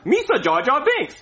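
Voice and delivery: high, cartoonish alien impression; spot-on silly high character imitation; Jar Jar Binks. Shown as a demonstration. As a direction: show off a goofy sci-fi sidekick voice. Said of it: "Jar Jar Binks, off the dome".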